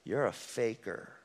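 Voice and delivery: slow, soft, low sneer; a slow quiet accusation, like a taunt. Retold or imitated. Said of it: "he'll say".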